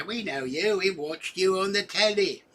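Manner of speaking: mock British fans recognizing him; lightly British voice of fans spotting him; imagined Londoners. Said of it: "And then they'll say".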